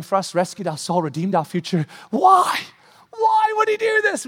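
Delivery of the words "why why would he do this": high, loud cry of why; impassioned cry. Real, not acted. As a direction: cry out the question to the congregation. Preacher crying out the question.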